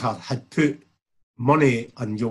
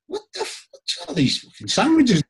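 mock outraged yell; 10 semitones higher than usual. Waking up, asking roommate Carlo about the sandwiches, mock outraged yell. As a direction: blurt it out like you just woke to a mess.

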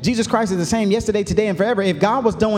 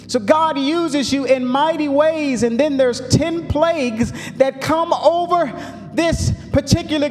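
rising, building sermon cadence with long held words; fiery preaching; 8 semitones higher than usual. Acted. Pastor preaching to his church, climbing, full-force sermon delivery. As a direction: preach it out to the congregation, pitch climbing.

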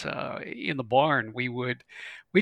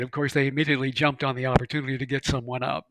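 ordinary delivery

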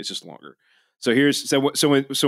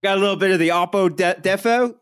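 put-on higher, quick joke Australian accent; mock Australian accent; 7 semitones higher than usual. Host defies his co-host's warning, higher mock Australian accent. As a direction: lay on a cheeky Australian accent, pitched up. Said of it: "I'm gonna do it again".

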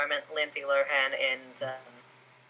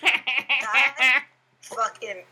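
words squeezed out through laughter; laughing; 4 semitones higher, 3 dB louder, much slower than usual. Real, not acted. Podcaster cracking up at the crybaby joke, laughing. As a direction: laugh helplessly while talking. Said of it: "laughing reaction".

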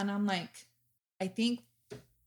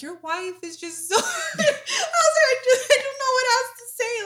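very high, louder, giggling fangirl babble; laughing gush; 10 dB louder than usual. Retold or imitated. Telling Julian about his wife, high laughing gush. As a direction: giggle through it, starstruck and lost for words. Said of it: "And I'm like".

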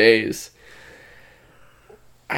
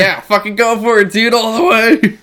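hyped-up cheer; 8 semitones higher, 5 dB louder than usual. Retold or imitated. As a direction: cheer him on all the way. Hyped quote of himself.

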